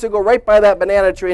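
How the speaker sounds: normal talking voice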